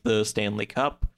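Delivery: ordinary talk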